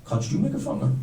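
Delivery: discreet approach. Acted. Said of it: "walk up to somebody and say".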